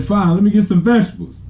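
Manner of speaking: high-pitched imitation of a kid; huffy little brother. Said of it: "He's like".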